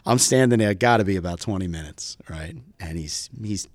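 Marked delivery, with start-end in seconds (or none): none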